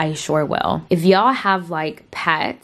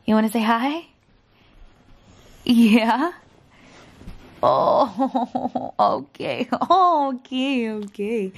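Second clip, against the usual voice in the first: put-on baby talk to dog; 5 semitones higher than usual. Cooing to her dog again.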